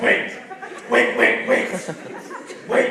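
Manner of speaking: loud, repeated commands; real mad. Acted. Angry husband halts his family, shouting in bursts. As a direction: fire out each word as a loud order. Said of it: "gonna get real mad".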